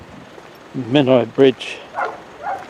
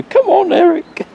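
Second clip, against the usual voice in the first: high, loud mock call to a dog; amused imitation of owners calling their dog; imitated shout; 6 dB louder than usual. Imitating owners shouting at tiny dog Eric, high mock shout. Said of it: "they're shouting at him".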